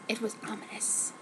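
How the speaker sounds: hushed mock-spooky whisper; ominous whisper. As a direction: breathe it like a cheesy horror trailer. Reader mocks ominous line.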